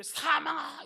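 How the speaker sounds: high, forceful shout on 사망아; loud proclamation. Shown as a demonstration. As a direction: belt out 사망아 from the belly. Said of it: "이렇게 읽으세요". (힘차게 외치는 선포)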